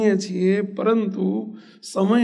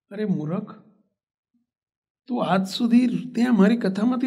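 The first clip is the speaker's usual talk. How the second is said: acted gentle reproach, pitch climbing well above usual; mild mock scolding; 5 semitones higher than usual. Guru chides his disciple for crying over a dead goat, mild scolding. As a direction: chide with rising exasperation, without shouting. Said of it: "મીઠો ઠપકો આપ્યો".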